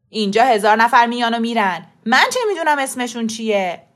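loud, defensive shouting; angry denial. A narrator's reading. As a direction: protest loudly as if wrongly accused. Shopkeeper loudly denying in quarrel. (انکار با داد و عصبانیت)

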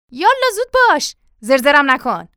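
loud, high hurry-up order; impatient shout. Acted. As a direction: shout at him to get moving. Father orders servant to hurry.

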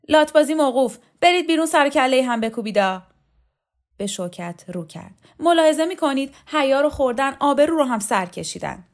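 high, loud, outraged reprimand; woman wiped tea off her face, scolding the thugs loudly. Acted. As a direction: shut the brawl down in indignation. Pari scolds the brawlers.

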